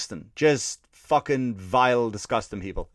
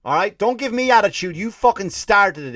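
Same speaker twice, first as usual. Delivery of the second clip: higher, louder indignant telling-off; angry retort; 6 semitones higher, 5 dB louder than usual. Retold or imitated. Comedian yelling at an Italian waiter, indignant and loud. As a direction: shout back at the waiter, outraged. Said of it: "I'm like".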